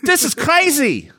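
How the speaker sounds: high, loud panicked yell; hammed-up high shriek of alarm; mock yell. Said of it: "he yells".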